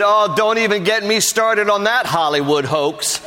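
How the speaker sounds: high mock voice through laughter; imitating him laughing. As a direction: laugh while playing the dismissive man. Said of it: "He said".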